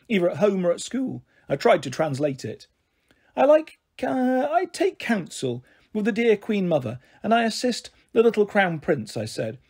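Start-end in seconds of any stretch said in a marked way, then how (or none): 3.4-9.1 higher-pitched, lightly put-on regal voice; queenly airs. A narrator's reading. Girl translating her home life for Bindweed, queenly airs. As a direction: improvise grandly, feeling for royal words.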